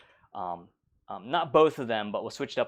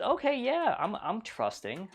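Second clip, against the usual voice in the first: much higher, slow, naive yes; trusting Molly; 10 semitones higher, slower than usual. Retold or imitated. Molly trusts the creepy troll, mock naive high voice. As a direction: play it wide-eyed and gullible, lightly.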